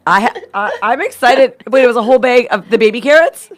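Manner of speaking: ordinary talk, nothing marked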